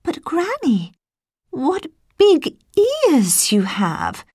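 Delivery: put-on high child's voice, slowing at end; little girl voice. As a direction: ask wide-eyed like a small girl. Child questions disguised wolf.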